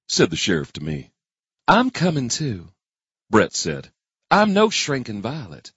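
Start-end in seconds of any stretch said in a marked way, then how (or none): 1.7-2.7 higher, slower woman's voice; a hint of a firm woman speaking; lightly voiced woman. A narrator's reading. Brett voiced in story. 4.3-5.7 a hint of a firm woman speaking; lightly voiced woman. A narrator's reading. Brett voiced in story.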